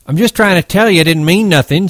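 high, loud insisting whine; defensive hothead protesting; Curly insisting. Acted. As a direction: push back high and urgent.

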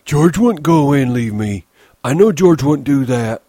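put-on high, loud childlike misery; miserable. A narrator's reading. Lennie insists George wouldn't leave him, miserable. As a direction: plead it high, falling away miserably. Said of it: "Lenny said miserably".

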